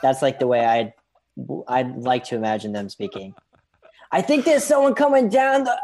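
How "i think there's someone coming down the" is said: put-on high, loud Boston accent; modern Boston accent. Colonist calling to Paul about someone coming, shouted Boston accent. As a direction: holler in a broad mock Boston accent. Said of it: "speaking in modern-day Boston accents".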